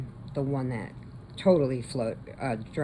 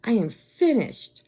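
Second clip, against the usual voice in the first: higher, emphatic fed-up declaration; retold indignation; 5 semitones higher, 4 dB louder than usual. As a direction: break it off as the betrayed girl. Retold betrayed girl breaking it off.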